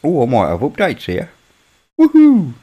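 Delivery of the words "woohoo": high, loud celebratory whoop; excited woohoo. Real, not acted. Streamer cheering at spotting updates, excited whoop. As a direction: let out a high, gleeful "woohoo".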